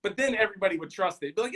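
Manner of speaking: normal talking voice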